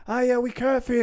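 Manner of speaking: high sing-song fake caring; mock sympathetic voice. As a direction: coo like an insincere relative.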